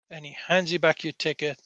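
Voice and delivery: ordinary talk, nothing marked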